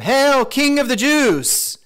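loud, high, drawn-out mock hail; exaggerated shouted salute, dripping with mockery; taunting salute. A narrator's reading. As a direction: jeer it slowly like a mocking soldier. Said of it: "saluted him and taunted him".

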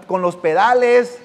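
normal talking voice

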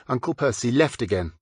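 ordinary delivery, nothing marked

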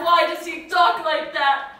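loud, high despairing cry; anguished shout about his words. Acted. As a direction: hurl the question out. Distraught outburst repeated.

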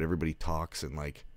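ordinary talk, nothing marked

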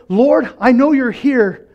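high, slow prayerful cry. Acted. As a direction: call out to the Lord, drawn out. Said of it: "cry out to Lord".

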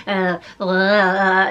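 drawn-out goofy dog grunt; funny dog noise. Retold or imitated. Owner imitating her playful dog, funny little voice. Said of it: "it's this funny little voice he gets".

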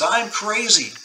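high-pitched mock-mad cry; retold shout. Prisoner feigning madness to his Japanese guards, shouting. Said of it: "and he shouts".

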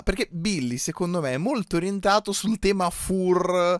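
ordinary talk, nothing marked

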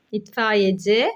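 usual speaking voice

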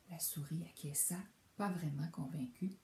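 ordinary delivery, nothing marked

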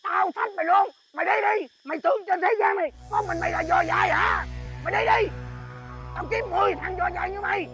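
loud, high heated quarrel; angry shouting. Acted. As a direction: dare him to leave, yelling.

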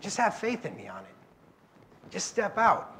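lightly voiced calm Jesus, hushed and reassuring; a hint of a gentle teacher easing his followers. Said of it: "He said".

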